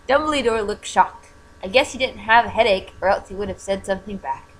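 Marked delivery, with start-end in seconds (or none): none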